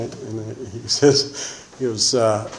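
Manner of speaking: ordinary delivery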